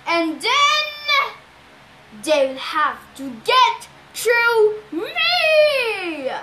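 loud, high, very drawn-out monster roar; roaring Cyclops. A narrator's reading. Cyclops boasts he is the last defence, roaring. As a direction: build to a long bellowed last word.

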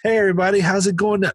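high-pitched comic's opener; stage greeting. Retold or imitated. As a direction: greet the crowd from the mic. Comic greets the crowd onstage.

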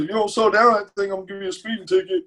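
mock stern warning voice; sarcastic impression of a heckler; mocking cop line. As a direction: parody the tired cliché cop threat. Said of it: "How many times have you heard".

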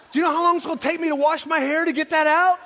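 high, loud, fast and laughing; laughing outraged complaint. Retold or imitated. Preacher retells fretting over oily hair, laughing loud protest. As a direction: blurt out the indignation in a rush. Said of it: "I was, like, going".

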